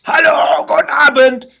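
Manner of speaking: high, loud yelled greeting; retold shout. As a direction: yell it hard, nobody's hearing you. Ruft in die Sprechanlage. (laut nachgespielter Schrei)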